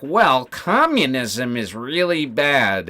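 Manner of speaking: slow, put-on pompous drone; slow mocking impression of a guest; mock panelist voice. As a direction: parody a smug pundit, drawn out. Mocking guests on Wick's stream.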